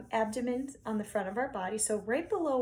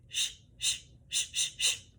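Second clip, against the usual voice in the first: unvoiced bouncy shh pulses; percussive shh. Shown as a demonstration. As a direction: bounce short hisses off the breath. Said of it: "percussive sounds".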